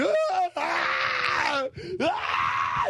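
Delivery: high hammed-up wailing cries; mock screaming kids. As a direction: wail like a dragged-off kid. Kids crying over regatta.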